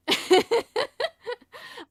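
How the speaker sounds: high, loud real laugh; loud burst of laughter. Real, not acted. Streamer laughing at chat's joke, loud and high. Chat joke about talking.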